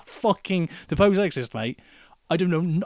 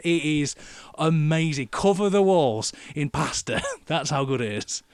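normal talking voice

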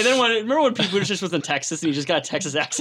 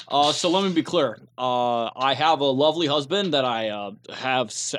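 low, halting politician impression sounding like Obama; Pete Buttigieg; 5 semitones lower than usual. Politician vouching for his lovely husband, halting mock sincerity. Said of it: "My Pete Buttigieg sounds more like Barack Obama".

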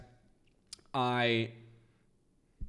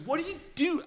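put-on much higher, eager drunken question; drunk curious question; faster than usual. Asking British strangers questions while drunk, high eager voice. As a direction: pepper them like a nosy drunk. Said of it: "And I'm just".

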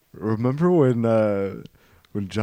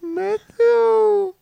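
very high falsetto, louder, drawn out; parent back from the dead; 5 dB louder than usual. Acted. Dead parent calling to the child who killed them, falsetto parent. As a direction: call out in a piping falsetto.

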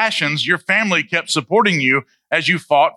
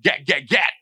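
playful gat-gat gun noise; mock gunshots. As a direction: fire off the sound like a pistol. Imitating gunfire sound.

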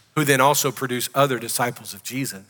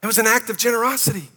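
raised, ringing pulpit voice, pitched higher; full-force preaching; 5 semitones higher than usual. Acted. Pastor preaching to the congregation about God's generosity, full-force preaching. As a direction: preach it out big to the whole room.

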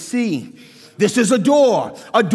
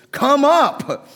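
voice of Jesus calling; 5 semitones higher than usual. Retold or imitated. Jesus's call quoted again.

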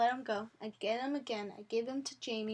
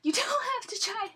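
mock indignation; 11 semitones higher, 4 dB louder than usual. Real, not acted. Mock-indignant echo of the other.

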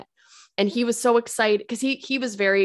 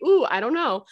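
normal talking voice

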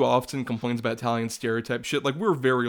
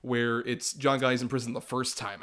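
ordinary delivery

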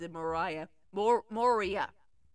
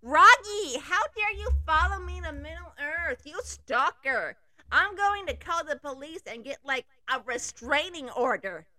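indignant scolding; 5 semitones higher, 4 dB louder than usual. Acted. Girl scolds stalker ex.

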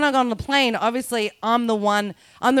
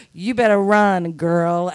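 lower, drawled impersonation; American woman voice; 5 semitones lower, slower than usual. Retold or imitated. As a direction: drag it out like an American agent. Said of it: "this is customer service in America".

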